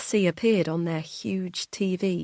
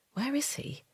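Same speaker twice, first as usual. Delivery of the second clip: hushed worry; 8 semitones higher, 8 dB quieter than usual. Acted. Worried inner thought.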